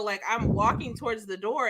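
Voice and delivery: ordinary delivery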